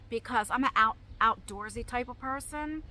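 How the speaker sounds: usual speaking voice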